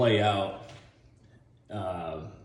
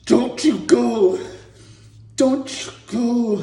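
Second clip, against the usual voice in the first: high, loud, slow mock anguish; hammed-up desperate plea; Rocky pleading; 12 semitones higher, 7 dB louder, slower than usual. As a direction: wail it slowly over a dying friend. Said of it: "and he's like".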